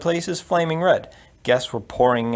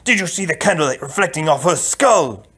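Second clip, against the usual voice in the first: louder, higher outraged question; indignant prince; 4 semitones higher, 5 dB louder than usual. Acted. Prince to the queen about the bald princess, loud indignation. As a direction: demand it in exasperated disbelief.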